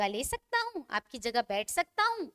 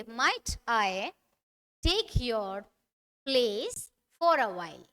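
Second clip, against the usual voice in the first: slow, pausing between words; broken into chunks; 4 semitones lower, much slower than usual. Shown as a demonstration. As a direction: split it up, pause after each chunk. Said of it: "धीरे धीरे बोलिए". (रुक रुक कर धीरे धीरे)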